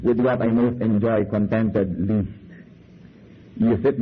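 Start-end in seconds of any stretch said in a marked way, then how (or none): 0.0-2.4 slow, low, measured recitation of verse; unhurried, lowered voice for the quoted verse; recited Shakespeare. Shown as a demonstration. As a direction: read the lines slowly like a quoted poem. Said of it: "Yung sabi ni Shakespeare na".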